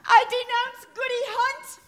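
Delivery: high, loud, wild denunciation; frenzied accusing girl. Acted. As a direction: shriek out names like one possessed. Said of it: "Rebecca foams at the mouth".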